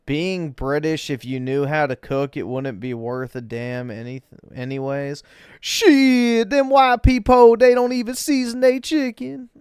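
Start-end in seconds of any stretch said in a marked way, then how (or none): 5.6-9.5 loud, much higher put-on voice; mock loud voice. Streamer joking about bland cooking in a loud high mock voice. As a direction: belt it out as a mocking caricature. Mocking joke about unseasoned food.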